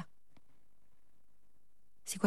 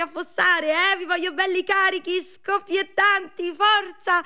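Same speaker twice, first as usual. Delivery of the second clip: high cheering voice; 11 semitones higher, 4 dB louder, slower than usual. Acted. Cheering on, high-pitched figure.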